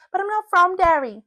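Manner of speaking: high, loud Northern Irish lilt; Derry Girls accent. Shown as a demonstration. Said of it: "they would lean more so into".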